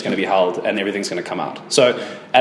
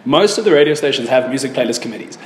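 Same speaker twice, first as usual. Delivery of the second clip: ordinary talk, nothing marked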